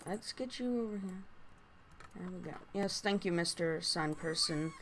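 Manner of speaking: ordinary talk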